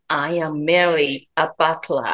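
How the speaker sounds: slow, clear English demo line; slow careful English. Shown as a demonstration. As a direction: spell it out slowly for learners. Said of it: "もう一回、あの、教えていただいていいですか". (ゆっくり丁寧な英語の手本)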